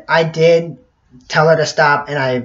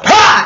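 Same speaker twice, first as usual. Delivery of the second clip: sudden high, loud "ah!"; mock yell; 9 dB louder than usual. Shown as a demonstration. Showing what the push was not, mock yell. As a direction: burst out with a high yelp. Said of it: "I didn't go".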